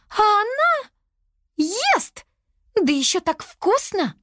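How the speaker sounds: outraged, very high-pitched voice; indignant. A narrator's reading. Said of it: "возмутилась Лиана". (возмущённо, на высоких нотах)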